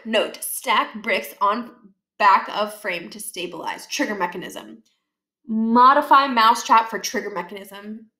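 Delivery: slow deliberate note reading; reading plan notes. A narrator's reading. Narrator reading the catapult diagram notes slowly. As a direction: read it out like blueprint labels.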